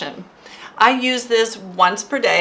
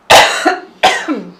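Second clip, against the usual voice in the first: coughing fit; 3 semitones higher, 8 dB louder than usual. Real, not acted. Choking on saliva mid-livestream.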